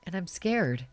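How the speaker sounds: put-on a nervous teen calling mom, holding back tears; anxious young caller. Caller voice continues.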